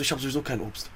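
soft, low deadpan line; quiet deadpan. Retold or imitated.

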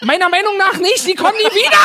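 high, mock-indignant quote; mock the grumpy audience's verdict.

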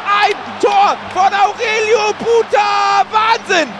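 high, loud amazed goal shout; screaming goal commentary. Real, not acted. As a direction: scream it out in disbelief. Wonder goal by Buta.

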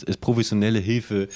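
ordinary talk, nothing marked

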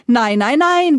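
loud, rising string of refusals; acted emphatic objection; contradicting. A narrator's reading. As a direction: cut the other off, climbing high on the last one. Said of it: "widersprach der Reiche".

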